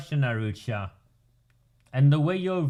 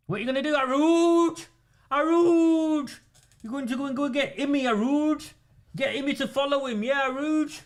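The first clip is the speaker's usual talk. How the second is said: mocking taunt; 10 semitones higher than usual. Taunting the councillor in mock voice.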